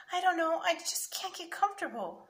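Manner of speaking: put-on high, plaintive little boy; a touch of sadness in a kid's voice; mildly sad child. A narrator's reading. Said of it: "Sammy said so sadly".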